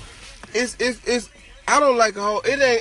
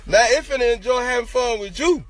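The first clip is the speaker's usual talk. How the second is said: ordinary delivery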